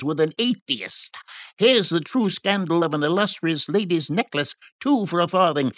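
high, lively street-seller patter; high-pitched peddler's sales cry; shouting hawker. A narrator's reading. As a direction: hawk scandal sheets to the crowd. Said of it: "the fellow shouted".

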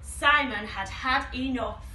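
ordinary delivery, nothing marked

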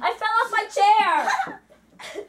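slow, laughing through the words; giggling, drawn out. Real, not acted. Laughing after falling.

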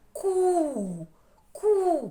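«Ку» high, loud, with a long vowel; протяжний склад. Shown as a demonstration. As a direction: sing out the syllable «Ку» for the class. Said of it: "Перший склад". (високе, гучне, протяжне «Ку»)

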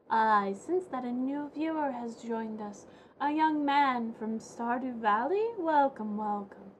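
slow, mystical seer voice; lightly voiced psychic, drawn out; fortune teller. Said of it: "Fortune teller?".

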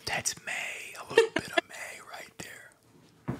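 very soft, secretive whisper; hushed aside to yourself; whispered realisation. Retold or imitated. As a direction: whisper it as a private discovery. Acts out private reading thought.

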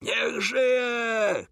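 high, drawn-out, held-back cheer; crowd cheer. A narrator's reading. Crowd around the royal carriage, high mock cheer. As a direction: imitate a crowd's shout without real volume. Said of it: "krzyczano dokoła".

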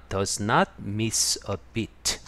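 each word drawn out; slow model phrase. Shown as a demonstration. Teacher stresses the negative idiom for learners, very slowly. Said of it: "teacher models the phrase".

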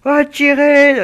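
sudden high excited call; excited shout. Real, not acted. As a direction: yell it out in surprise. Sudden fight breaks out.

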